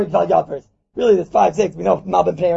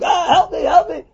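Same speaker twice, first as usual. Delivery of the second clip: higher, louder call for help; hammed-up shout for help; mock scream; 6 semitones higher, 4 dB louder, slower than usual. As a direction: cry out help me as if robbed. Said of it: "you start screaming".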